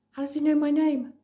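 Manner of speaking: high-pitched, startled schoolgirl; alarmed surprise. Acted. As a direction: ask it in startled disbelief. Schoolgirl alarmed stranger knows name.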